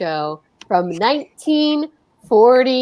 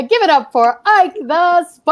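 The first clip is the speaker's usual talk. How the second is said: hype call-out; 6 semitones higher, 3 dB louder than usual. Real, not acted. Host introduces a guest performer.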